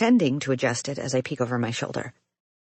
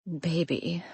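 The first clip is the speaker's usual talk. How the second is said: purring; 3 semitones higher, 6 dB quieter than usual. A narrator's reading. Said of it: "she purrs".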